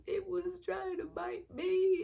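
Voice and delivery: a mock whimper of a frightened child; retold whiny excuse. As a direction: plead like a scared kid. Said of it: "And I was like".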